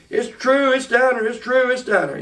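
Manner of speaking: high, excited mock holler; half-acted kids yelling the news. Out-of-breath kids hollering to the big brother. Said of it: "they all hollering".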